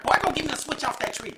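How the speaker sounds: put-on grandma ordering a child. Host recalls grandma demanding a switch, imitated scolding. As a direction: send the kid off like grandma would. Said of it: "by my grandma when I was little".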